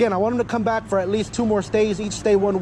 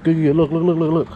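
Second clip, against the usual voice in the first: ordinary talk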